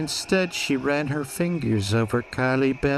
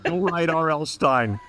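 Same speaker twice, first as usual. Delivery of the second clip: usual speaking voice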